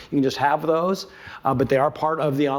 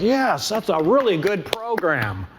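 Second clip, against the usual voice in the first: mock approval; 6 semitones higher than usual. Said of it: "people are like".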